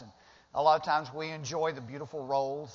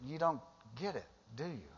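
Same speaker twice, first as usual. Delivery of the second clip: much softer, fading rebuke; gentle Jesus; 8 dB quieter than usual. Acted. Jesus softly chiding the disciples, much softer. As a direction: let it drop to almost nothing. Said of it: "he said".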